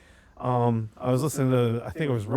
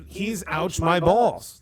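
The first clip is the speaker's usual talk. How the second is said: slow catchphrase; 3 semitones higher, much slower than usual. Retold or imitated. Quoting a viral catchphrase.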